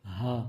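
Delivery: ہا low and soft from deep in the throat; حلق سے بھاری ہا; heavy throaty ha. Shown as a demonstration. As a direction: push ہا from the bottom of the throat, heavy. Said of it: "موٹی باری آواز والا".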